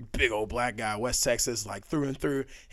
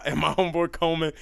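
ordinary talk, nothing marked

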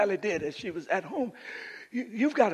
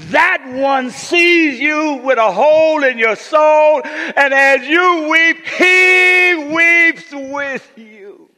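high, slow, drawn-out shout; climactic preacher's shout; 8 semitones higher, 17 dB louder, much slower than usual. Acted. Preacher assuring the hurting that Jesus weeps, climactic shout. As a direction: stretch each word at full cry.

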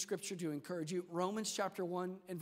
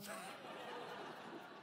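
breathy laugh; 13 dB quieter than usual. Real, not acted. Laughs at his story.

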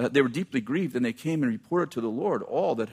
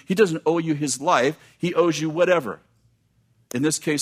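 usual speaking voice

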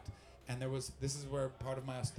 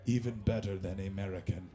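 very low mock-Russian voice; comic low Russian accent; imitated Russian accent; 8 semitones lower than usual. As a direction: pronounce approval in a deep Russian accent. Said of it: "and they went".

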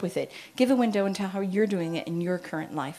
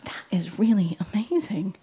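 awed whisper. Retold or imitated. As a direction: hush it like a discovery. Awed teen reading notes.

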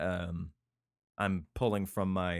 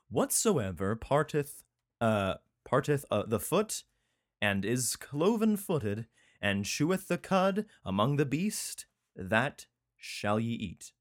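slow, deliberate Bible recital; measured declamation of a verse; scripture reading; 3 semitones higher, slower than usual. Acted. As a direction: read the law out phrase by phrase, drawn out. Reads scripture aloud.

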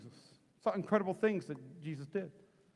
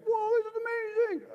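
much higher, comic cry of wonder; hammed-up amazement. Healed guard marvels at the miracle, high mock amazement. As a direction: play a mock-astonished guard.

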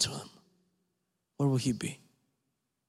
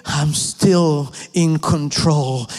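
slow, louder, weighty declaration; proclaiming; 4 dB louder, slower than usual. Acted. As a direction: declare each I am with full force. Preacher proclaims God's words building.

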